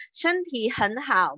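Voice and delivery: "Shenti hen hao" said slowly and clearly; slow Mandarin demo. Shown as a demonstration. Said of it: "Shenti artinya adalah badan". ("Shenti hen hao" diucapkan pelan dan jelas)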